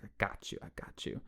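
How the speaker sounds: breathy whisper, barely voiced. Heroine on the phone with the killer, whispering. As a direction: hush it like she's keeping him on the line. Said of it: "she's like".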